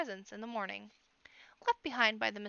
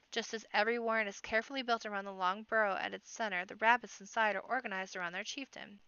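usual speaking voice

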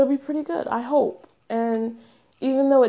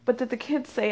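usual speaking voice

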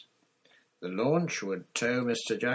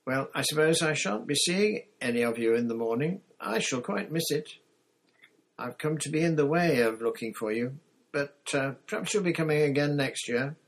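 higher, louder countrywoman saying goodbye; farmer's wife, fond farewell; 6 semitones higher, 5 dB louder than usual. Acted. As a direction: say goodbye as a homely farm woman. Voices Missus Dixon's farewell.

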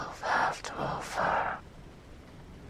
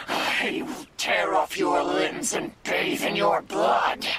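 loud shouted death threat; furious threat; 4 dB louder than usual. Acted. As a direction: bellow it at the captor. Demon threatens captor.

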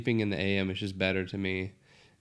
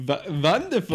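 higher, louder put-on wonderful; mock wonderful; 7 semitones higher, 5 dB louder than usual. Host mimics cohost's wonderful, silly higher voice. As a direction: throw the word back in a silly voice. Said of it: "I said wonderful".

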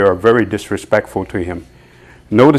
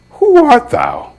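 incredulous question; 13 semitones higher, 3 dB louder, slower than usual. Retold or imitated. Crowd asks Jesus.

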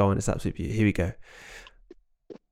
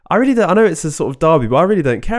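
louder, higher, insistent; emphatic; 6 semitones higher, 5 dB louder, faster than usual. Real, not acted. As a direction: push the words out. Emphatic about not caring.

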